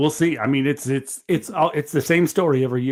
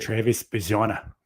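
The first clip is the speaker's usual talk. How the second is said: put-on slow, drawn-out Australian accent on the name; slow, mild Australian accent, said a bit softer; 5 semitones lower, 7 dB quieter than usual. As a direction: stretch the name out like an Aussie. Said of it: "that's really great".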